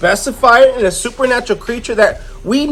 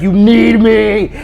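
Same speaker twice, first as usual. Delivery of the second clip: slow, drawn-out possessive monster; insistent monster; much slower than usual. Retold or imitated. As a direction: stretch each word as an acted monster's demand. Monster insists she needs him.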